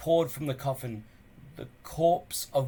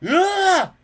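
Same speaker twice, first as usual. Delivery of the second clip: high, loud gurgled shriek; a corpse bursting upright with a gurgle; gurgling cry; 14 dB louder than usual. A narrator's reading. The corpse shrieks into Gobber's face, gurgling. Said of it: "gurgled the corpse".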